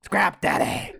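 gravelly put-on voice with a rising end; lightly rasped tag line; a hint of a gravelly tough guy; gravelly impression. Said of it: "he has more of a gravelly voice".

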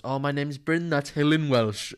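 sing-song Welsh character impression; Bryn from Gavin and Stacey. Quoting a sitcom to a co-host, Welsh character impression. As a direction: do an emphatic, slowed-down Welsh introduction. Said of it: "when Bryn goes".

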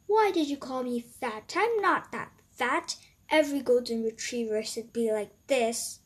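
loud, indignant dog character; a touchy, offended dog; big dog voice. Acted.

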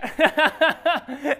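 high hearty burst of laughter; loud laugh. Real, not acted. Laughs after snake story.

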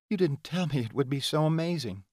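lifted, bright-toned woman's voice. Acted. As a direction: voice the heroine up high. Heroine's line in dialogue.

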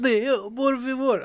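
a hint of a Russian accent; mock Russian accent. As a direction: lightly put on a Russian accent. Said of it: "put on a Russian accent".

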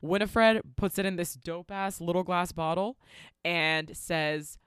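usual speaking voice

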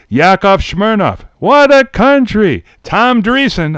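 high, loud host introduction; projected roll-call voice; Bobby Van. Retold or imitated. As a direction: announce it like a game-show emcee. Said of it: "And when he introduces".

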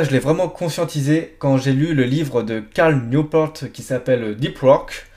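ordinary delivery

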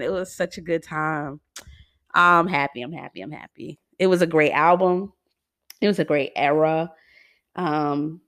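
usual speaking voice

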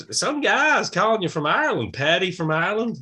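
high, loud mock wife voice; imitating American wife. Wrestler's wife passing on a phone message, high-pitched mimicry. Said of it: "she'd be like".

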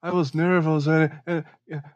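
nervous American inmate voice, slow; American accent. Pretend. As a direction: play a shy American prisoner, hesitant. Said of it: "Ele vai falar".

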